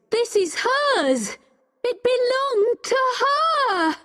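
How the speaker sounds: high-pitched heated outcry; impassioned insistence. A narrator's reading. Becky holding up the necklace to Richard, crying out.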